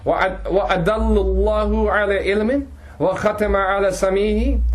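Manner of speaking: quick, melodic recitation of the verse; Quran recitation. Acted. Teacher quoting the ayah to the class, in a flowing chanted recitation.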